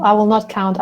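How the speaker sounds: usual speaking voice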